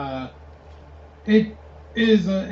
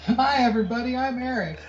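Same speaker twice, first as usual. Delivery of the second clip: high-pitched mock co-host greeting; hammed-up high hello to listeners; podcast host intro; 6 semitones higher than usual. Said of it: "let's try it right now".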